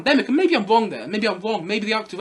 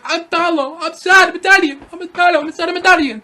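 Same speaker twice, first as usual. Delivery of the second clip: hammed-up Italian accent, high and loud; Jared Leto; 8 semitones higher, 8 dB louder than usual. Reviewer mocking an overacted Paolo, loud fake Italian accent. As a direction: wail it in a bad mock-Italian accent. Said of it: "I'm not doing a fantastic Italian accent".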